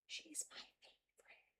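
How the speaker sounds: soft unvoiced whisper; whispered aside. Real, not acted. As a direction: whisper it so she won't hear. Whispering about cat.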